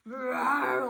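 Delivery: loud hungry growl; bear growl. Acted. Bear growls his choice of jam, loud. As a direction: growl out the answer. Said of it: "Old Bear growled".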